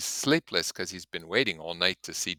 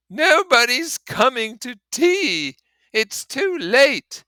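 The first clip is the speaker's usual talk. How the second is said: much higher, louder and slow, giving up; despairing Gatsby; 12 dB louder, much slower than usual. Acted. Gatsby telling the narrator nobody will come, high, loud, slow despair. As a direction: wail it out as if all hope is gone.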